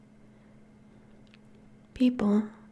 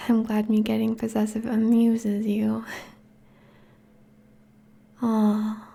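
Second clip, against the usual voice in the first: usual speaking voice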